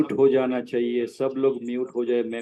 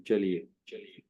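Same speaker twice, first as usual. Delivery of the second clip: whispering; 5 semitones lower, 8 dB quieter than usual. Real, not acted. Muttering to himself while setting up.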